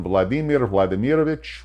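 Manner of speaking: "Vladimir Vladimirovich" said the Russian way, rolled r, stress on the middle syllables; Russian pronunciation. Shown as a demonstration. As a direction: give "Vladimir Vladimirovich" its native Russian sound. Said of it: "or as we say in Russian".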